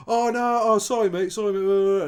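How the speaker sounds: high, loud mock apology; hasty apology. Men realise he's not American, hasty apology. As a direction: imitate flustered, over-keen backtracking.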